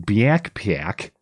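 "backpack" said as "byack-pyack", slowly; mock Midwestern "backpack" with a Y glide after each consonant; Y-inserted vowel. Host objecting to the accent, "backpack" mimicked as drawn-out "byack-pyack". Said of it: "There's no Y in backpack".

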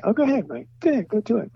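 high, bright old cartoon voice; squeaky cartoon-legend voice, kindly; Mel Blanc voice. Imitating Mel Blanc's cheerful permission.

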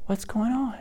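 put-on high, soft little-girl voice; innocent toddler. Toddler in timeout acting innocent, in a high soft voice. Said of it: "put her in timeout".

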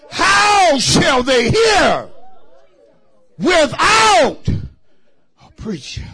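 slow, drawn-out chanted scripture; preaching intonation. Real, not acted. Pastor intoning scripture slowly to the church. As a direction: stretch each word like a whoop.

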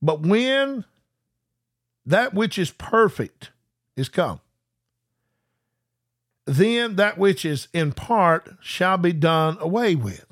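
very slow, phrase by phrase with long pauses; read real slow. Shown as a demonstration. Preacher rereading the verse real slow for viewers. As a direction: stretch every word and pause between phrases. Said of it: "I'm gonna read that real slow to you".

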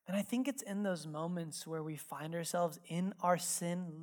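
ordinary delivery

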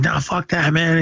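high-pitched retold defiance; mild mock outrage. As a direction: act out an indignant comeback without shouting. Victor's retold defiant threat.

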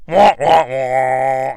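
descending "wah wah" trombone noise; sad trombone imitation. Host imitates the captive mocking Sonya, sad trombone. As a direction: mimic a deflating trombone, last note drawn out. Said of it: "like, sad trombone sound".

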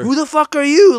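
loud, high mock scream; hammed-up furious challenge; imitated angry screaming. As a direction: imitate an enraged man confronting someone. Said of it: "he's just fucking screaming at me".

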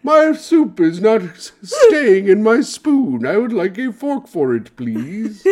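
high, slow, prim society lady; eloquent debutante. Pretend. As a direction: put on a prissy debutante voice. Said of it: "I speak like an eloquent debutante out on the town".

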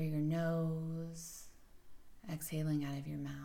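soft, even meditation voice with long pauses; guided meditation. Acted. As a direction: softly guide the listener into settling and breathing.

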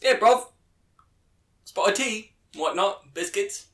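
mock London accent, pitched well up; a cheeky British accent, mostly high. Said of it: "Oh, perfect".

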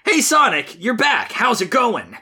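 high, exaggerated cartoon voices; cartoon dialogue. Reader voices Sonic greeted and saying he's mad, high and hammy. Reads Knuckles and Sonic lines.

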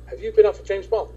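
put-on high, loud excited girl's voice. A girl at the print shop gushes at him, high and loud. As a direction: gush at him like an eager fan. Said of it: "She says".

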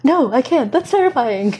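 high, loud frightened protest; panicked refusal. Retold or imitated. Her scared self refusing to approach him, loud and high. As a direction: recoil from the idea in mock panic. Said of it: "internal battle with ... going".

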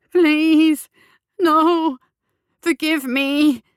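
high, loud frantic begging; pleading. Acted. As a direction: beg for mercy, drawing it out. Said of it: "he tried to plead".

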